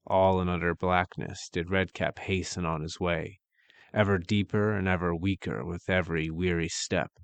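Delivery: plain reading voice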